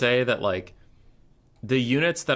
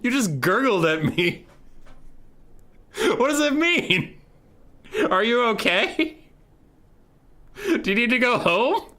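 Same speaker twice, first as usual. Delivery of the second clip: much higher, louder baffled talking-to; incredulous mock rant; 11 semitones higher, 4 dB louder than usual. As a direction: scold the gurgling soldier in disbelief. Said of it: "you're like".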